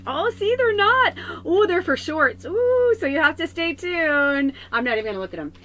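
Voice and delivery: high, loud, giddy squeal; excited squeal. Real, not acted. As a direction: squeal with delight at the surprise. Excited discovery of cards for shorts.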